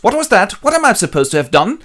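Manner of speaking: high, loud and fast outrage; indignant shout. Acted. Creon shouting at Oedipus over the accusation, high and fast. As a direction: yell in protest. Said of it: "comes and shouts".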